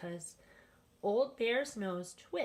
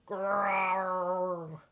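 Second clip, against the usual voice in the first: bear growl; 4 dB louder than usual. A narrator's reading.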